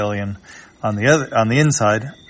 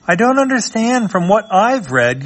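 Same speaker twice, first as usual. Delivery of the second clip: much higher put-on voice; high mom voice; 11 semitones higher, 4 dB louder than usual. Mom voiced in comic reading.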